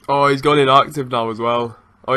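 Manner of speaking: normal talking voice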